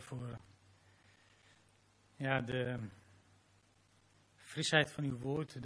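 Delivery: closing prayer after the sermon. Real, not acted.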